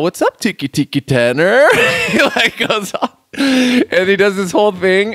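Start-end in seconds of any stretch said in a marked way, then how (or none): 0.0-1.7 higher, louder surfer greeting; surfer turtle voice. Retold or imitated. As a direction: tease the kid like a surfer turtle. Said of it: "he's all".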